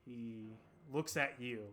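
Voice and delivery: slow, hushed scene setting; game master narration. Acted. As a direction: let it sink softly, with long pauses.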